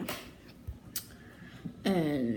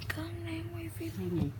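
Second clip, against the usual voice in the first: whisper; 9 semitones higher, 7 dB quieter than usual. Real, not acted. Repeated to mom, whispered.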